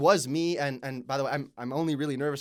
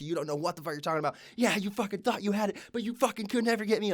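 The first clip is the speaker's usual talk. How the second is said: mock taunting scream; 8 semitones higher than usual. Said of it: "screaming so loud".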